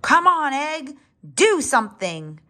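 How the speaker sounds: high, pleading mock shout; exasperated shout. A narrator's reading. Fed-up father dinosaur shouting at the unhatched egg.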